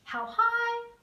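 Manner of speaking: HIGH lifted up in pitch; "high" said high and loud to show pitch. Shown as a demonstration. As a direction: pitch the word high way up and hold it. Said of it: "You can match pitch".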